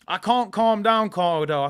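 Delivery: usual speaking voice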